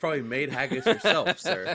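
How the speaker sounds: laughing, loud and teasing; teasing through laughter. Real, not acted.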